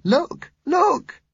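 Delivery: put-on excited high-pitched child calling attention; excited cry. A narrator's reading. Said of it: "Susan cried excitedly".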